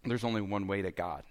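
low, soft, matter-of-fact telling; bold, calm statement. Shown as a demonstration. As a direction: say it firmly but quietly, without pushing. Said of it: "bold would be telling a person".

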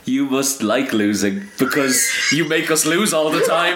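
high, rising mock taunt; mock wrestler burn. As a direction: deliver the burn high, building towards laughter. Acting out Joey's burn.

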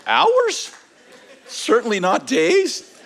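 high-pitched, incredulous squeak on each guess; comic disbelief climbing into a squeak; alarmed questioning. Retold or imitated. Husband guessing how long his wife will be gone, alarmed.

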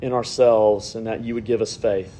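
low, measured prayer voice. Acted. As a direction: pray aloud, unhurried and earnest, pitched low. Leads the congregation in prayer.

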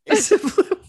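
news reporter voice. Pretend.

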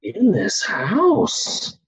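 drawn out, a tripping man discovering his own life; slow dazed wonder. Retold or imitated.

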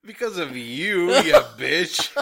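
loud, high, laughing jab; laughing mock insult. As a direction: fake outrage through laughter.

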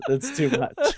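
helpless real laughter through the words; laughing. Real, not acted. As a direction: protest while cracking up. Cracking up at the impression.

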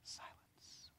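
breathy whisper of one word; barely audible, almost no voice; whispered silence. Shown as a demonstration. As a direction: let the word hang in a whisper. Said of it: "dramatic pause, whisper".

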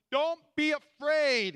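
slow, high, loud reassurance; calming Jesus. Acted. Jesus calls to terrified disciples, loud reassurance. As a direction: soothe them firmly.

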